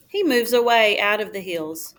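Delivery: usual speaking voice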